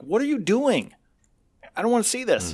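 exasperated retort. Retold or imitated. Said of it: "I'm like".

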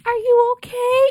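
concerned lady voice. Retold or imitated.

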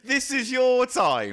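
high, slow grandiose echo; hammed-up echo of triumph; mock proclamation. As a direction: intone it grandly. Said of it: "you said".

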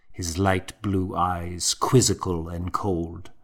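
ordinary delivery

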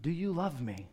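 soft, hushed, intimate asking; tender question. Acted. Preacher voicing Jesus asking the congregation, soft and tender. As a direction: ask it quietly, as if to one person. Jesus asks us today, softly.

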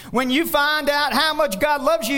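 loud, high, fervent call; shouted climax. Real, not acted. Pastor telling the church about God's love, fervent shout. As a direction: lift it up and shout it out.

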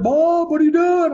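high, loud call of a name; hammed-up high-pitched phone greeting; exuberant greeting.

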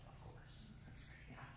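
unvoiced, muttered sarcasm; sarcastic whisper. Counselor hearing the hurt kid scream, whispering sarcasm to himself. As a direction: breathe it out under your breath, dripping sarcasm.

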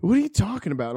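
high, baffled what; confused surprise. Retold or imitated. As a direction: act out bewildered confusion. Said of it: "he's like".

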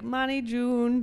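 high, drawn-out motherly call; doting mom. Retold or imitated. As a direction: sing out the name like a doting mom. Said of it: "and she's like".

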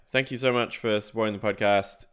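ordinary talk

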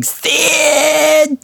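very high shrill calling out; Nancy Spungen shrieking. Retold or imitated. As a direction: screech the name across the room. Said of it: "just walking through the whole thing going".

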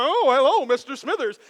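hammed-up suave hello; imitating the charmer. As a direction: switch back to the charmer. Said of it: "he'd run over".

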